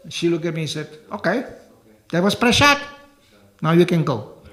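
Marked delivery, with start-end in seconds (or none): 1.1-4.4 bossy dismissal. Retold or imitated. Lady dismisses him.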